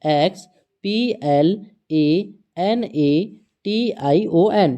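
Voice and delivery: slow, evenly spaced letter-by-letter spelling; teacher dictating letters one at a time. Shown as a demonstration. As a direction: read each letter out slowly for the class. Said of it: "सबसे first letter".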